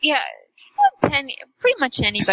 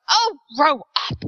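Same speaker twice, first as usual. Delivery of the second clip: mock exasperation; 5 semitones higher, much slower than usual. Retold Rose snapping back.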